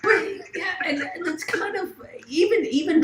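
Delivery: laughing through the words, high and bright; half-shouted laugh as you answer; breaking into a laugh mid-sentence. Real, not acted. Laughing reply to joke.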